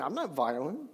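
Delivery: low, soft and deadpan; deadpan quiet. Pretend. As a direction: drop it to a dry, quiet denial. Ironic self-justification after hating someone.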